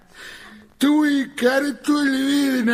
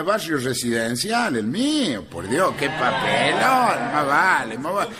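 usual speaking voice

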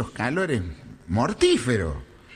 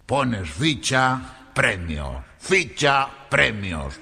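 slow alternation, dropping low on the second word; one word up, the next deep, evenly spaced; slow slot-machine routine; 6 semitones lower, much slower than usual. Acted. Galician describing the slot machines, slow see-saw delivery.

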